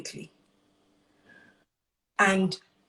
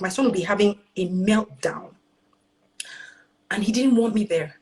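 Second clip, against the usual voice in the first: ordinary talk, nothing marked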